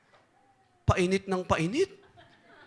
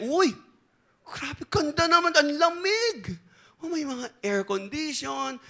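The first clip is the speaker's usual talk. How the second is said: amazed delight; 6 semitones higher, slower than usual. Acted. Politician amazed at cool hell.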